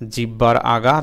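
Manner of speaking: ordinary talk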